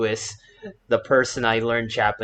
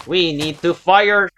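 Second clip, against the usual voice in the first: urgent call; 7 semitones higher, 7 dB louder, slower than usual. Real, not acted.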